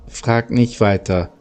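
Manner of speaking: ordinary delivery, nothing marked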